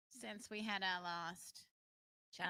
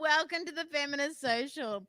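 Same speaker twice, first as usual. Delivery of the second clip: laughing through the welcome; 4 semitones higher, 9 dB louder than usual. Real, not acted. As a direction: announce it while giggling. Opening the show while laughing.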